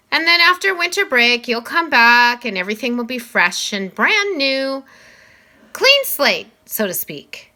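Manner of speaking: a principal gently laying down the rules; Mister Tushman. Acted. Voices Mr. Tushman.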